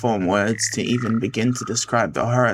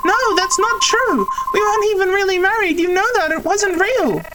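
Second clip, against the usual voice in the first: a much higher, pleading voice; desperate pleading character; 4 dB louder than usual. Acted. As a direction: beg as if about to cry. Character argues back.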